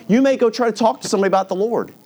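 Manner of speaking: ordinary talk, nothing marked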